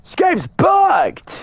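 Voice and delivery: high, slow, stretched yell; frustrated shout. Real, not acted. Frustrated outburst at game.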